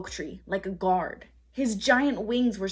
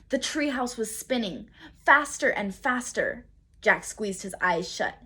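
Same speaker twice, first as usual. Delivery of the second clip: ordinary delivery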